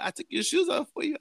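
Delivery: high-pitched friendly woman imitation; nice, mock-sweet. Woman to the man she just woke, mock-sweet. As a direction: coo it high, sweetly helpful. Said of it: "being all nice and shit".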